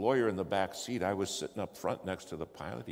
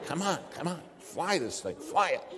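frantic urging; 7 semitones higher than usual. Retold or imitated. Urging pilot while hitting him.

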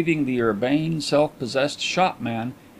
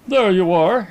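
louder, higher burst of relief; relieved exclamation; 7 semitones higher, 6 dB louder than usual. A narrator's reading. Detective to the dealer, relieved loud exclamation. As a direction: let the relief spring out loud. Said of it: "exclaimed Mister Carlyle, much relieved".

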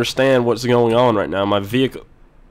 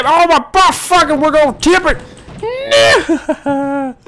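very high, loud shriek; frantic falsetto yelling; panicked shout; 6 dB louder, slower than usual. Real, not acted. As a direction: scream as the truck starts to tip. Truck starts tipping over.

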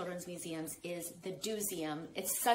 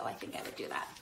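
whispered confession; whispered aside; faster than usual. Real, not acted. Author confessing to listeners in a whisper. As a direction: lean in and whisper it. Aside about wanting mango.

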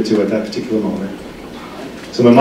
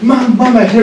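panicked calling; 12 semitones higher than usual. Retold or imitated. Nurse panicking over her mother slumped in the chair. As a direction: call out in alarm, voice shooting up. Thinking he killed his mother.

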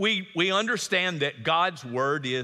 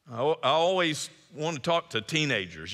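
ordinary delivery

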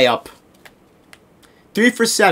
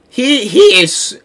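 voice crack; 4 semitones higher, slower than usual. Real, not acted. Player stammering about an overpowered opponent, voice cracking. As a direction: voice cracking upward mid-word. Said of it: "nice voice crack".

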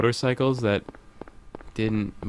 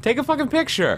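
high-pitched, exasperated outburst; insist, voice jumping way up; exasperated demand. Real, not acted. Ordering co-player to take a picture, exasperated.

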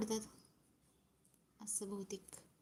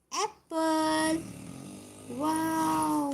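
drawn-out coo; 3 semitones higher, much slower than usual. Real, not acted. Mother drawling to toddler. (बाळाशी लांबवून बोलणे)

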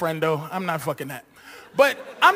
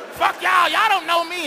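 put-on defiant teen shout; 8 semitones higher than usual. Teen yelling at crowd.

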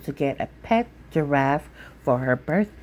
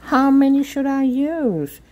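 much higher, earnest little voice; innocent child question; 10 semitones higher, 5 dB louder than usual. Acted. Sophia asking mother, high and earnest. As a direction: ask sweetly, eager to comply.

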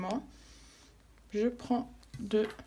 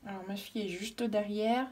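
normal talking voice

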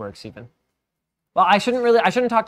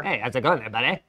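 put-on light Canadian accent, friendly; 3 semitones lower than usual. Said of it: "It's my best Canadian accent".

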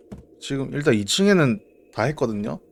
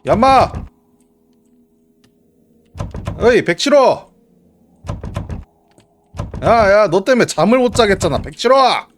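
loud yell at the neighbor; shouting; 7 semitones higher, 8 dB louder than usual. Real, not acted. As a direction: call out angrily, full voice. Yelling at game neighbor.

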